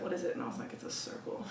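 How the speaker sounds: very soft, fading to a near-whisper; hushed retold exchange. Client recalling hypnosis session to hosts, hushed. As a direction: quote a tender memory under the breath.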